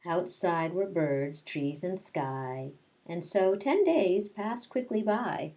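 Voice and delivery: put-on reading a picture book aloud to children; lilting read-aloud, rhyme by rhyme; storytelling voice. Teacher reading Madeline to her class, storytelling voice.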